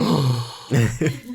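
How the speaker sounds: big delighted gasp; sharp, awed intake of breath; excited gasp. Retold or imitated. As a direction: suck in a thrilled breath. Acting out excited reaction to news.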